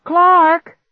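very high, loud, urgent call. A narrator's reading. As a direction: yell the name across the house. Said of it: "I called out again".

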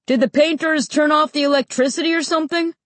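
high, quick shouted question; calling out. A narrator's reading. Dad calling from the back about the power, quick high call. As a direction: call it through fast and high. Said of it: "Dad called from the back".

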